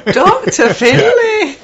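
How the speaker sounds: very high, slow Scottish warble; hammed-up Scottish accent. Shown as a demonstration. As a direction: draw it out in a loud, silly Scottish falsetto. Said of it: "Missus Doubtfire".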